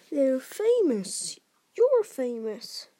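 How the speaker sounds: slow, loud, emphatic; amazed insistence. A narrator's reading. Hagrid telling Harry of his fame, amazed. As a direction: spell out the astonishing news.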